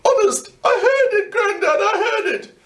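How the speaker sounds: high, breaking, near-tearful plea; frightened pleading. A narrator's reading. Boy pleading with Grandad about the ghost, frightened and tearful. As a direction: beg him to believe you.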